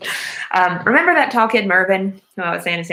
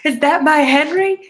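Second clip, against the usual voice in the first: high, louder disbelieving cry of the name; freaking out; 9 semitones higher, 4 dB louder than usual. Retold or imitated. Blind mother hearing her son speak, high startled disbelief. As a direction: call his name like you can't believe it. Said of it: "freaking out and is like".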